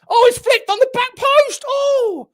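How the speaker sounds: high, loud cry at a near miss; excited commentary shout. Real, not acted. Commentator calling a flicked-on corner to viewers, excited shout. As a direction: shout it out as the chance flashes by.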